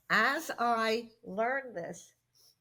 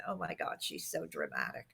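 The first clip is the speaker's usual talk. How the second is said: hushed mock aside; soft gossiping aside; retold aside; 7 dB quieter than usual. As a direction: murmur it like gossip to a neighbour. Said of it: "turned to somebody next to her and said".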